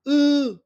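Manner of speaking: E high and loud, pushed from throat; E like a kid acting silly, high; Vietnamese ư sound. Shown as a demonstration. Teacher models Vietnamese vowel to class, silly high sound. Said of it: "on va le lire comme un E".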